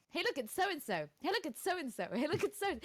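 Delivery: lilting mock-excited imitation; imitated excited pointing-out. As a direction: mimic someone eagerly spotting one person after another. Imitating chatty woman in queue.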